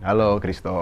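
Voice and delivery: put-on mildly deepen your voice to impress a girl. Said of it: "suaranya gue berat-beratin".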